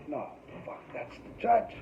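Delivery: hushed unvoiced panic, almost breathless; whispered panic. Seeing the judge's name, he whispers in panic. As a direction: whisper it to yourself in dread.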